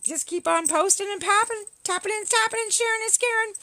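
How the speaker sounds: rising, mocking lilt; taunting singsong. Mocking commenters' activity.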